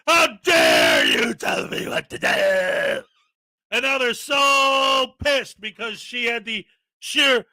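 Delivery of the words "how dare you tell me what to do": mock indignant shout. Frauditor yelling at the chair, slow mock outrage. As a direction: drag it out like a self-righteous tantrum.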